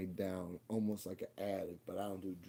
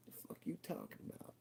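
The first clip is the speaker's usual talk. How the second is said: breathy disbelieving whisper, pitched up; shocked whisper; 8 semitones higher, 11 dB quieter than usual. Retold or imitated. Son whispering to his dying father in shock. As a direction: hiss it in disbelief, barely voiced.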